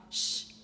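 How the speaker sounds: "sh" alone as a hushing consonant; SH sound. Shown as a demonstration. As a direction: sound out CH as a bare "sh". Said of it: "a gente tem o C H". ("sh", som do CH francês)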